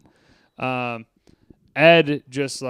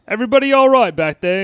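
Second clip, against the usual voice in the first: higher, louder, fast call-out; quoting the driver; 8 semitones higher, 7 dB louder, faster than usual. Retold or imitated. Driver calling to the back seat, higher and louder. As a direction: shout it back over the seats. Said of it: "He's like".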